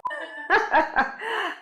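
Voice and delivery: real high burst of laughter. Real, not acted. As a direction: crack up after the sign-off. Laughs after the sign-off.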